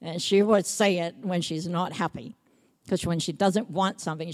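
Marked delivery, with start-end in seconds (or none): none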